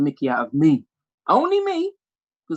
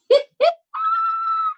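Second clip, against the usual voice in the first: long piercing mock-outraged shriek; high screech; 4 dB louder than usual. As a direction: let out a very high drawn-out squeal. Mother's outraged reply.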